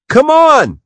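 high, loud call to hurry someone along. Acted. Matt calls Scott from the cabin door to the boat, a high shout. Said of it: "Matt called".

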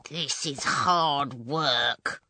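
slow, effortful groan of words; laboured, weary husband voice; straining under load. Acted. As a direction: drag each word out as if hauling heavy bags.

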